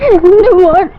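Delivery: tender words through tears; fondly, on the edge of crying; tearful. Acted. Mother to the son who brought her clothes, tearful. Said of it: "എന്തിനാ കരയണേ".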